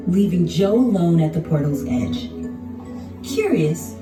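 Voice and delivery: usual speaking voice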